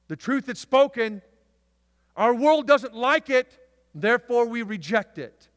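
very loud, high shouted sermon climax; preaching at full force. Acted. Preacher thundering about the truth to the church. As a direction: drive it home to the congregation.

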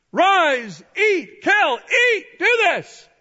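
high, shouted string of orders; commanding voice from heaven. Acted. God ordering Peter to eat, loud commanding shout. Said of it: "a voice came to him saying".

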